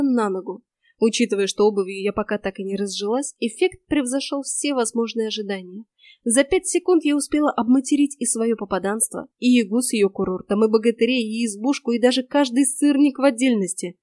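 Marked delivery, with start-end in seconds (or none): none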